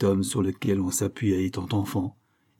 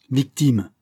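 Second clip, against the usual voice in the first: louder, falling exclamation; indignant echo; 3 semitones higher, 5 dB louder than usual. A narrator's reading. Father scorning his son's excuse, indignant. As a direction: throw the word back scornfully. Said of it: "fit monsieur Berthomy".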